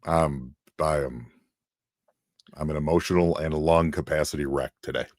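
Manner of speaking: low, soft, worn-out voice; dropped half octave. Real, not acted. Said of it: "drop that little half octave".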